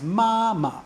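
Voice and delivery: "ma, ma" as high level syllable, then short neutral one; high steady "ma", brief unstressed "ma"; first then fifth tone. Shown as a demonstration. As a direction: hold the first "ma" high, drop off on the second. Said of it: "that's first tone".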